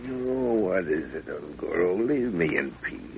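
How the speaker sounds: just woken and wanting sleep; groggy. Acted. Professor woken by Nguru, slow and groggy.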